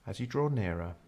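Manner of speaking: normal talking voice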